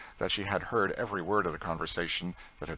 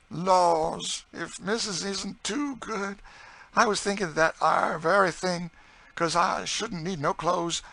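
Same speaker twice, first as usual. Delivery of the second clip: very high, excited dialect voice; delighted Southern cook; 11 semitones higher, 5 dB louder than usual. A narrator's reading. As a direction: gush with joy in folksy Southern dialect. Narrator voices Chloe delighted.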